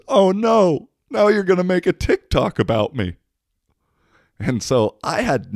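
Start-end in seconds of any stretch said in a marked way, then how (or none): 0.1-3.1 very high, louder dismayed whine; mock the guy's panic, pitched high; whiny drunk guy. Guy quoted.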